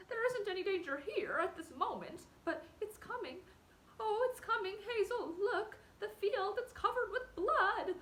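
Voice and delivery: high, loud panicked cry, slowing at the end; a terrified cry as the vision closes in; wretched terror. Acted. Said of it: "answered Fiver wretchedly".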